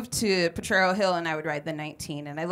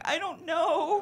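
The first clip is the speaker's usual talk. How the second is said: high, slow sheepish laugh; sheepish kid; 10 semitones higher, much slower than usual. Retold or imitated. Kid answering her best friend, sheepish and slow. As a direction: laugh it off, clueless. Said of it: "I'm like".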